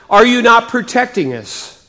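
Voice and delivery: usual speaking voice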